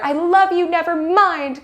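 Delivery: high, hammed-up sudden love declaration; enemies-to-lovers confession. Former enemies running to each other, high mock declaration. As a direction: blurt it like a rom-com lead running in. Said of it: "they, like, run to each other".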